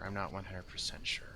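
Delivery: quick unsure whisper; whispered aside. Real, not acted. Gamer doubting himself in a quick whisper. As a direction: mutter it fast under your breath.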